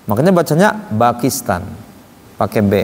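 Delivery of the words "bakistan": Pakistan said as Bakistan with a b. Retold or imitated. Teacher imitates Arabs reading Pakistan with b.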